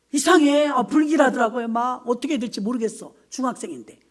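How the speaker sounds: usual speaking voice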